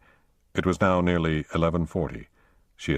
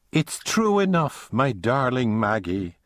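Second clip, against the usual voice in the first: slow, much higher broad Irish lilt, tender to Maggie; broad Irish accent; 6 semitones higher, slower than usual. Acted. Connor greeting Maggie at the airport, in a broad Irish accent. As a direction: lay on a thick Irish brogue, drawn out. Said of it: "he said in a broad Irish accent".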